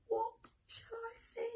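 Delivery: high, soft fearful question; scared. Acted. Reader to children, mock-scared in the tunnel. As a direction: ask it nervously in the dark tunnel.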